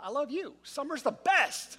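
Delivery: high, building to a drawn-out cheer; gushing joy. Retold or imitated. Dad to son on first day of summer, gushing joy. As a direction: gush it, stretching out the last word.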